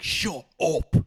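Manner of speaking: high, loud snapped shut up; hammed-up angry outburst; mock shout. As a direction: yell it at a chattering guesser. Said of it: "I'm like".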